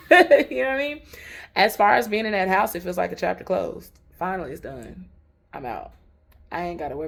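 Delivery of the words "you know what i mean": laughing. Real, not acted. Laughs after her joke.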